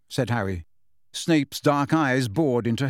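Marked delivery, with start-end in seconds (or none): none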